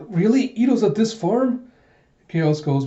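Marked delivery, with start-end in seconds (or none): 0.1-1.7 high-pitched disbelieving question; shocked. Retold or imitated. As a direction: blurt it in startled disbelief. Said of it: "Alita is just shocked by this news".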